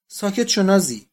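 a sharp, loud shout to silence her. A narrator's reading. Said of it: "بابا گوریو فریاد زد". (با فریاد، بلند و تیز)

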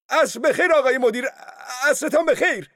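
loud goodbye. Acted. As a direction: sing out a loud farewell. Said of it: "او بلند گفت".

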